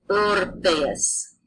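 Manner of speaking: "orpheus" said slowly with a plain P, no F; Latin pronunciation. Shown as a demonstration. Teacher showing the class that PH is P, slowly. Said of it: "P H makes a P sound".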